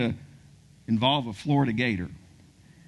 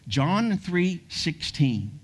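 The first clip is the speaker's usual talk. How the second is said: slow emphasis; 2 semitones higher, much slower than usual. Acted. Sermon emphasis.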